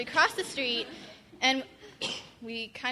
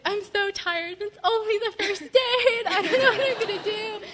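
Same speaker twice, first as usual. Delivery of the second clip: higher, louder half-laughing complaint; mock weary whine; 9 semitones higher, 8 dB louder than usual. Recalling her discouraged morning to the group, mock whine. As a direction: replay her tired morning lament lightly.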